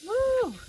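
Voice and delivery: very high "woo" of heat; sudden high-pitched yelp-like woo; whoop. Real, not acted. Reacting to the heat.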